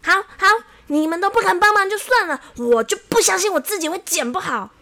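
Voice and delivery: high, loud indignant outburst; storybook huff at full voice; angry and loud. A narrator's reading. Xiao Wang defies barbers who refused him, angry and loud. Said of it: "非常的生气， 立刻很大声地说".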